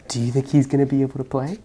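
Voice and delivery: ordinary delivery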